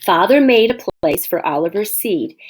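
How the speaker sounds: plain reading voice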